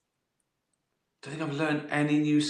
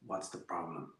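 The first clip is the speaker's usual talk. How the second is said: casual detective; 6 semitones lower, 14 dB quieter than usual. Pretend. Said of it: "I go".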